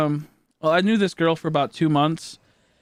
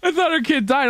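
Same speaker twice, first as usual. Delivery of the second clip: laughing; 10 semitones higher than usual. Real, not acted. Streamer cracking up at his own mix-up, laughing through it. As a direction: let the laugh burst through "died".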